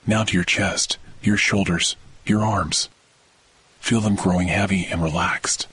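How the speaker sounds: ordinary delivery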